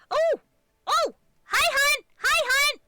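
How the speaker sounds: loud, very high delighted squeal; real high-pitched excitement; excited greeting. Real, not acted. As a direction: squeal hello to a dear friend. Greeting a friend arriving with raid.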